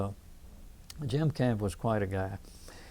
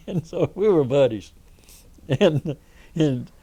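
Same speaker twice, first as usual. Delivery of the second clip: real laugh through the words, louder and higher; 4 semitones higher, 6 dB louder than usual. Real, not acted. Storyteller recalls becoming buddies with a friend's mother, laughing. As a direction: chuckle as you tell it.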